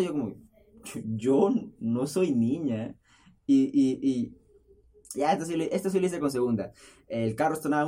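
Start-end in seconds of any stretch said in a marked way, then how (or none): 1.0-3.0 very slow, low mock reply; deadpan correction. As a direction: draw out a puzzled, low correction. His hesitant reply to the neighbor. (respuesta lenta, grave y desconcertada)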